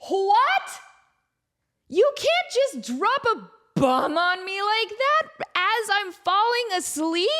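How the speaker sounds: jolted awake and protesting; indignant shock. Retold or imitated. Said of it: "just like".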